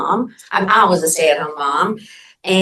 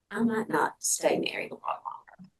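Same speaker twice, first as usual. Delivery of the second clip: whispering; 3 semitones higher, 11 dB quieter than usual. Real, not acted. Confiding aside.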